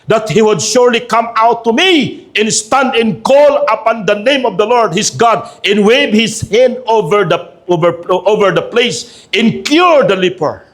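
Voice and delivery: high, slow indignant Bible reading; preacher voicing an affronted general; angry Naaman. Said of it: "Naaman was angry and".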